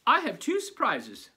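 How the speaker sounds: high, loud eager teacher; bubbly teacher announcing surprises. Acted. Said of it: "said Missus Zappi".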